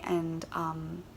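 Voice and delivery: ordinary talk, nothing marked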